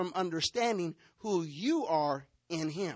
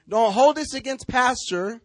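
loud warning; 4 semitones higher, 10 dB louder than usual. Real, not acted. Preacher warning the congregation not to blame him, loud. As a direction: call out loudly to the whole church.

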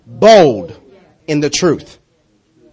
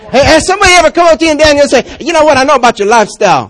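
calling out loud; 7 semitones higher, 5 dB louder, faster than usual. Real, not acted. Preacher calling out to congregation.

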